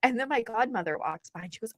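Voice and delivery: ordinary talk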